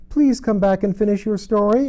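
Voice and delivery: high, loud call after someone leaving; Alice calling after the mouse. A narrator's reading. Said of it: "Alice called after it".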